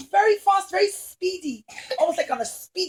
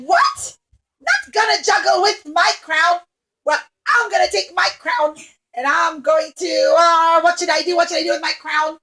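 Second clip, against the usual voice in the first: angry princess tantrum; 3 semitones higher, 8 dB louder than usual. Acted. Princess rages when clown refuses her crown, shouting. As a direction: throw a spoiled tantrum. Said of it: "She got very angry".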